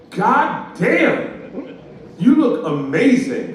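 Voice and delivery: loud, high, drawn-out compliment; big emphatic wow, words stretched; gushing admiration. Acted. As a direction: act out an amazed stranger hollering praise. Stranger gushes a compliment aloud.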